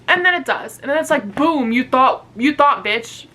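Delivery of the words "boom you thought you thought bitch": punchy, gloating mock taunt. Book taunting a reader who hoped for better, mock gloating. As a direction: hit it like a gotcha.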